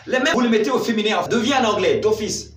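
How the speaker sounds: fast, loud and high; excited. Real, not acted. Teacher thrilled by the easy rule, excited. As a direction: rush it out with delight.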